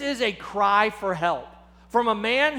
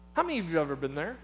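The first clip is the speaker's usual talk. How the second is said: ordinary talk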